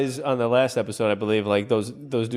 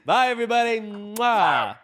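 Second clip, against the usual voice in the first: mock farewell; 7 semitones higher than usual. Real, not acted. Mock signing off with kiss.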